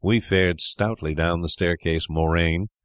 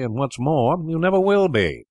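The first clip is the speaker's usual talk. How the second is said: put-on higher, rising childish taunt; taunting boy; 9 semitones higher, 3 dB louder than usual. Brother teasing his sister, higher childish taunt. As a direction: crow it over your sister.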